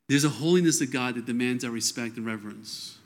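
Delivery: ordinary delivery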